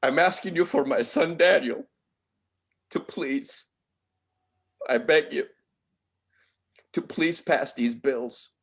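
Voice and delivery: a grieving father holding back tears; emotional plea. Real, not acted. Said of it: "I'm sorry I'm so emotional".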